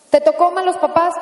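normal talking voice

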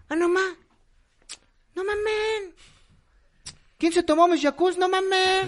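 mock rich guy griping at the fridge; imitating a fresa. Carlos Slim imagined at his fridge, whiny and high. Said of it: "va a decir así de".

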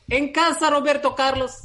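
high slipping mock accent; hammed-up accent going Russian; fake accent. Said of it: "me está saliendo de acento ruso". (acento falso que se va a ruso)

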